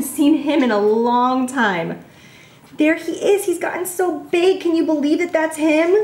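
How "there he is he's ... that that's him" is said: cooing at dog. Real, not acted.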